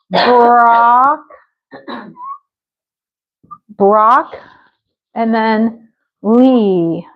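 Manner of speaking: "brock", pause, long drawn-out "lee"; split syllables. Shown as a demonstration. Teacher shows how she says it, syllable by syllable. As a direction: sound out broccoli as BROCK and LEE. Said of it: "I say".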